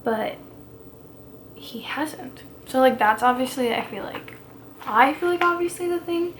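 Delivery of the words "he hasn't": whisper. Real, not acted. Dramatic reveal of secret.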